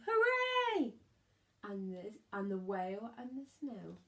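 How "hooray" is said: high, loud joyful shout; shouted cheer. A narrator's reading. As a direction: cheer like a whole crowd. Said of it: "villagers shouting".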